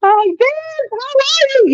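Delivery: high bright welcome to a newcomer; excited greeting. Real, not acted. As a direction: greet a friend who just joined. Greeting someone joining the chat.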